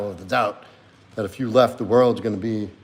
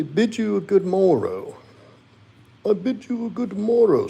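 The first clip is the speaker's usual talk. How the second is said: pet's voice; 9 semitones higher than usual. Acted. Streamer voices his dog saying good night to chat, high pet voice. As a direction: speak as the pet, squeaky and sweet.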